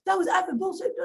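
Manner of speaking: high-pitched retold outburst; mock angry cussing. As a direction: imitate a worked-up salesman cursing. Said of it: "Like, cussing up".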